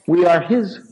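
slow, weighty sermon build; slow preaching build. Acted. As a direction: lay each word down slowly for effect.

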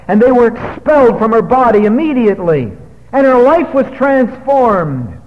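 high, loud sermon proclamation; triumphant preaching. Real, not acted. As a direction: proclaim the deliverance from the pulpit, drawing out the end.

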